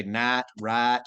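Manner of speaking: "night, right" with the I flattened toward "nat, rat"; Texan long I. Shown as a demonstration. Explaining Texan trouble words, "night, right" as "nat, rat". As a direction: say "night, right" with a flat, open Texan vowel. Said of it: "Texans have trouble with the words that rhyme with I".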